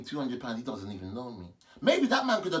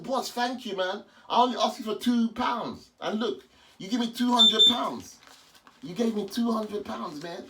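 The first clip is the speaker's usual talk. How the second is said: calling out; 8 semitones higher, 6 dB louder than usual. Real, not acted. Calling thanks after the man.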